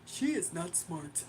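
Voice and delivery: low, slow put-on man's voice; mocking a commenter in a deep slow voice.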